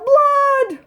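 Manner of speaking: high, drawn-out panicked-style shout; mother's yell. A narrator's reading. Mother yells at the sight of blood, high loud yell. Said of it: "Loretta's mother yelled".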